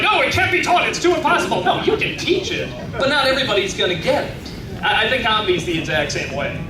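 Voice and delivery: ordinary delivery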